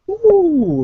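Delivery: long, loud, high ooh sliding down; high falling "ooh". Real, not acted. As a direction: let out a high swooping ooh. Game master reacts to the shot.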